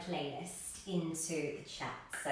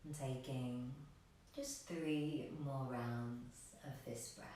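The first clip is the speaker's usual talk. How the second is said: soft, unhurried meditation voice with long pauses; guided meditation; 3 semitones lower, 8 dB quieter than usual. Real, not acted. Yoga teacher guiding extended exhalations, soft meditation voice. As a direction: lead them quietly toward stillness.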